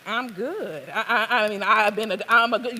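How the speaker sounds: put-on flustered stammer in a Southern accent; Southern man stammering to defend himself; stuttering. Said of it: "You blaspheme and you stutter".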